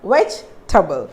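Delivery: "vegetable" in three syllables, VEJ-tuh-bul; "vegetable" said veg-table, middle E silent; correct pronunciation. Shown as a demonstration. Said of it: "It becomes silent".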